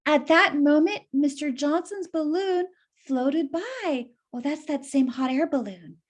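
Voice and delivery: usual speaking voice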